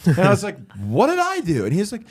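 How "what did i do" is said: high-pitched bewildered protest; hammed-up incredulous comeback; mock protest. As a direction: plead innocence, pitched way up. Protesting innocence in retold argument.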